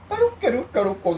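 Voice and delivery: very high, stretched-out squeal of nonsense syllables; imitating grandchild's vocal drill. Retelling his grandchild's warm-up, squealing the syllables high. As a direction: squeak it like a child doing voice practice. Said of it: "孫がよく言ってたね".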